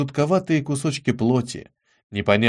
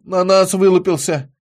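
ordinary delivery, nothing marked

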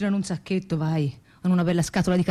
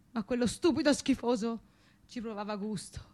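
ordinary delivery

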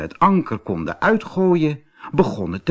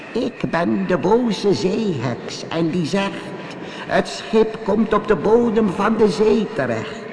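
boze zeeheks; 9 semitones higher than usual. Acted. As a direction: chant the curse in a high witch voice. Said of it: "de zeeheks haar betovering zeggen". (boze zeeheks met hoge, slepende stem)

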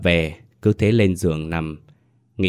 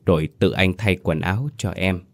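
ordinary delivery, nothing marked

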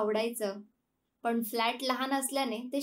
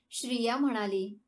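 plain reading voice

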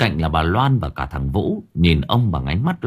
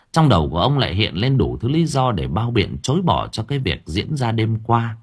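ordinary delivery

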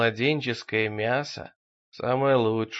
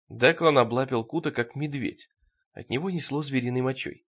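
usual speaking voice

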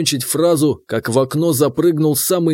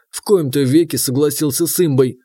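plain reading voice

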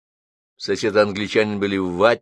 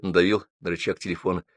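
ordinary delivery, nothing marked

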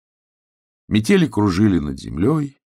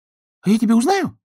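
high duck voice; 4 dB louder than usual. Acted.

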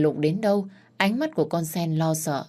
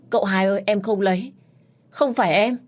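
pleading; 4 semitones higher, 5 dB louder than usual. Acted. Maid pleads her innocence.

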